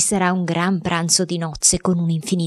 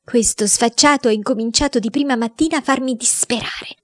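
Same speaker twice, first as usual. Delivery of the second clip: trembling with rage; 6 semitones higher than usual. Acted. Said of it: "tremando dalla rabbia".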